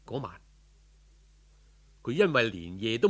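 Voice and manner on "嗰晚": plain reading voice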